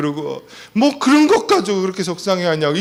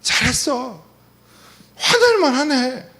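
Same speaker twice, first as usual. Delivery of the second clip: higher, slow reassuring voice of the wife; wife's reassurance; 7 semitones higher, slower than usual. Retold or imitated. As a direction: play the supportive wife soothing her husband. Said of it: "우리 아내가 늘 하는 말이에요". (남편을 다독이는 아내의 높고 느린 목소리)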